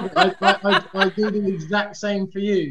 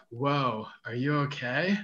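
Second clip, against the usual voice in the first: low, softer mock American drawl; put-on American accent; 5 semitones lower, 5 dB quieter, slower than usual. Shown as a demonstration. Showing off an American accent on request, low and relaxed. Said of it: "hearing [name]'s American accent".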